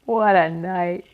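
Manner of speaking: slow, loud husky rasp. A narrator's reading. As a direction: rasp it out slowly. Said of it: "he said in a husky voice".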